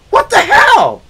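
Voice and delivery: high-pitched indignant exclamation; exasperated disbelief. Retold or imitated. Caller watching the officer drive away, exasperated. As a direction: cry out in baffled outrage. Retold outburst at officer leaving.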